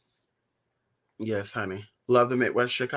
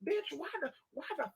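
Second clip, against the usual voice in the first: high, much softer frantic scolding; frantic phone rant; 9 semitones higher, 8 dB quieter than usual. Retold or imitated. Calling friends after oversleeping, high hushed frantic scolding. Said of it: "I'm on the phone calling people".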